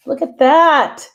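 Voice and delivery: look, isn't this great; excited show-off. Real, not acted. Showing off her book pages.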